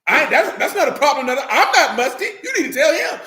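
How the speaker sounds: loud, high, half-laughing objection; hammed-up protest, pushing the complaint back; mock indignant protest. Acted protest to a tattler.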